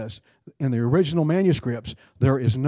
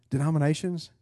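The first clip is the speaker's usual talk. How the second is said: normal talking voice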